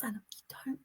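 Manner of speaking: drops to a breathy whisper; secretive hushed aside. Real, not acted. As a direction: confide it under your breath. Confiding how others don't understand.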